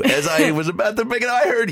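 ordinary talk, nothing marked

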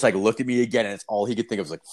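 usual speaking voice

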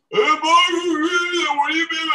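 a goofy high caricature of a stunned caller; mock high whine; mimicking Stuttering John. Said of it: "He's like".

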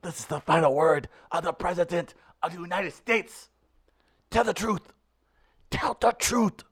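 Nigerian accent attempt. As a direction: try a Nigerian accent as in Concussion. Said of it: "think Will Smith in the movie Concussion".

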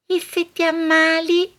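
high, anxious little inner voice; a tiny worried voice in your head. Acted. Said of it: "quella vocetta dentro che ti dice". (vocina interiore acuta e ansiosa)

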